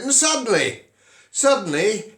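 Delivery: sudden high jump on suddenly, held in suspense; spring the twist on the listener; dramatic suddenly. A narrator's reading. Telling a fairy tale, dramatic suddenly.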